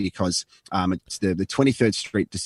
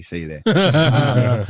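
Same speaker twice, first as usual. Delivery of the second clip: slow drawn out; 3 semitones higher, 5 dB louder, much slower than usual. Retold or imitated. Drawn-out sign-off.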